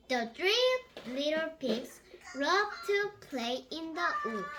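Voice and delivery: ordinary delivery, nothing marked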